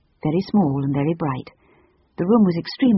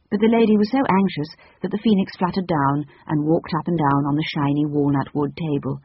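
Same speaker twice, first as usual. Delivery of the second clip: ordinary delivery, nothing marked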